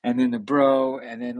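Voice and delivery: usual speaking voice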